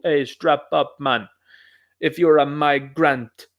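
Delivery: imitating a Jamaican accent, slowing on the last words; a playful mock Jamaican accent, drawn out at the end. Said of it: "that's actually much better Jamaican accent".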